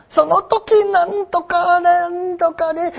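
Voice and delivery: high, slow falsetto-like woman's voice as a tayu; imitating a tayu doing a female role; storyteller voicing a woman. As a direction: chant it like a joruri narrator playing a woman. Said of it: "女の人".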